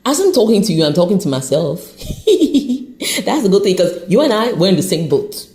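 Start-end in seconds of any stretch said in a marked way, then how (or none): 2.0-3.2 high-pitched giggle. Real, not acted. Admitting she's talking to herself, giggle. Laughs at her own remark.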